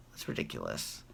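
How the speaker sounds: low whispered repeat of that's ridiculous; whispered aside. Real, not acted. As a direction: breathe it again under your breath.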